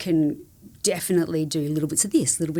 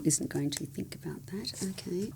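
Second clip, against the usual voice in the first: whispered; 10 dB quieter than usual. Real, not acted. Stalling for a question.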